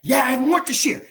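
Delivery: a wife eager to keep venting, loud; mock wife outburst. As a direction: blurt it out, loud and high. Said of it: "my wife would go again".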